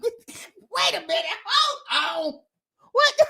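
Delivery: normal talking voice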